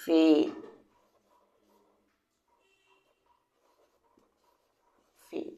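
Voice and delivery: ফেট said with a long a, as in fate; long-vowel fate. Shown as a demonstration. Teacher confirms ফেট after a student answers. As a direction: say ফেট with the vowel saying its name. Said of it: "good".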